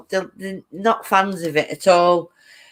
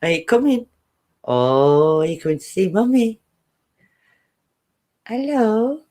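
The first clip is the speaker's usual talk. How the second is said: talking to the cat; 3 semitones higher than usual. Real, not acted. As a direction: coax the cat to come to Mummy. Calling her cat on the stairs.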